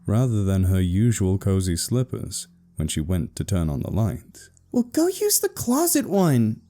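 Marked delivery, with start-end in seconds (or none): 4.7-6.6 high-pitched grumpy sister; grumbling big sister in a high voice. A narrator's reading. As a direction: brush him off irritably. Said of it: "Lisa muttered grumpily".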